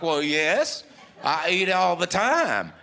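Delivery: loud, high, drawn-out "of course"; emphatic mock reply. Woman answers fella asking if she ever eats, emphatically. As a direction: answer as if it's obvious, hammed-up.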